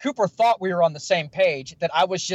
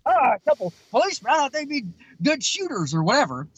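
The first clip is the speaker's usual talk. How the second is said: higher, quick mocking taunt; mocking carny; 6 semitones higher than usual. Carny mocking two cops at his rifle game, teasing. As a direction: rib the cops like a smug carny. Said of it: "He's making fun of us".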